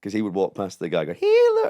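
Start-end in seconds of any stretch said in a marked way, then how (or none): 1.1-1.7 squeaky-high falsetto, punched out louder; falsetto sock puppet. Retold or imitated. Sock puppeteer mocking a passer-by, high falsetto. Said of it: "the falsetto sock thing".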